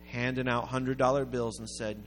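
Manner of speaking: ordinary delivery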